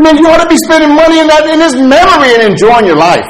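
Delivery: high, loud, rapid telling-off; exasperated scolding. Acted. Pastor scolds the crying parishioner.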